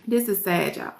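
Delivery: fighting tears, barely voiced; quiet and choked with real emotion; choked up, soft. Real, not acted. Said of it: "I'm trying to hold back tears".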